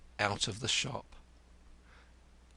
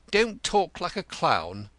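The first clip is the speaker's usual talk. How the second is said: high, testy rebuke; snappish; 7 semitones higher, 5 dB louder than usual. Acted. Cantercot rebuking the chirpy housekeeper, snappish. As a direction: cut her off with irritation. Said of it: "Cantercot snapped".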